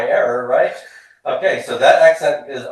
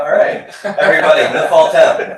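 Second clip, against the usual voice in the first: laughing cue; 5 semitones higher, 8 dB louder than usual. Real, not acted. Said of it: "cueing class to repeat".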